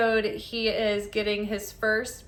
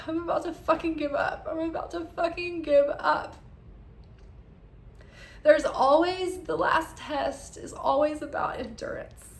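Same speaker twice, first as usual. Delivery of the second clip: real high, choked-up crying voice; emotional, tearful; 7 semitones higher than usual. Real, not acted. Moved by Jesus's words to Nathaniel, tearfully emotional. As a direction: push the words out high through tears. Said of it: "I'm sorry, I'm getting emotional".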